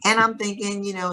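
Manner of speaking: ordinary talk, nothing marked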